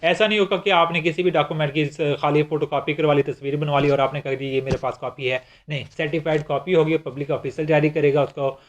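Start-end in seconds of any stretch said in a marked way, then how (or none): none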